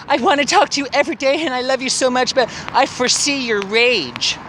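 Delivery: high, mocking read of his letter. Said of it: "even in his letter".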